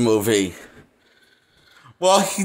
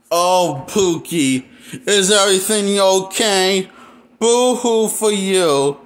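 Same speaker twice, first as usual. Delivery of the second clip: raised, drawn-out fake pity; mock sympathy; 3 semitones higher, slower than usual. Reviewer to grieving movie hero, mock sympathy. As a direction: coo a sarcastic boo-hoo at them.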